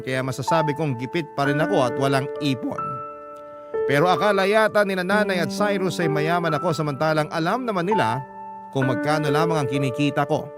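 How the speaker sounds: normal talking voice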